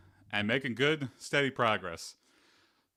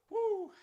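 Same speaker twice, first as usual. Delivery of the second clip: high whoop of triumph; triumphant woo. Real, not acted. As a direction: cheer after the last rep. Cheer after finishing the set.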